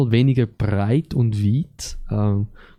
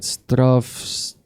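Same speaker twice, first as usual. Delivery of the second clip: sounding it out piece by piece; hesitant beginner reading Russian; halting reading; 2 semitones higher, much slower than usual. Real, not acted. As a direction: stretch and split the Russian word, then retry it. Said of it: "I can't read it that well yet".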